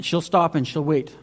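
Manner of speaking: usual speaking voice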